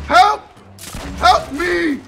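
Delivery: high, loud cry for help; shouted plea. Real, not acted. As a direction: call out urgently for rescue. Calls for help under attack.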